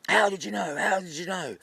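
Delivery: high, repetitive pestering; nagging. Acted. Wife who won't give up questioning husband, nagging. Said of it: "All day she nags him".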